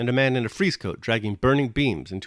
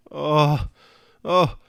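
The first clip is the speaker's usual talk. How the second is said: higher, louder startled yells; crowd yelling; 6 semitones higher, 5 dB louder than usual. A narrator's reading. Crowd yells as the barn roof collapses, loud yell. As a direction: cry out as the roof crashes. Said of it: "yelled the crowd".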